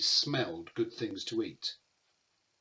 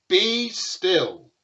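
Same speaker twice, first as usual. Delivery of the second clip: sharp, much louder and higher command; commanding Max; 8 semitones higher, 10 dB louder than usual. Acted. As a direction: order the beasts to freeze. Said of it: "Until Max said".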